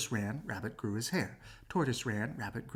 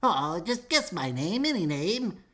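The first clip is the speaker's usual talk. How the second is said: louder, slow wheedling little man; pleading little man; 5 semitones higher, 5 dB louder, slower than usual. Acted. Little man begging Cinderella to guess his name. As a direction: beg her to guess, drawing it out.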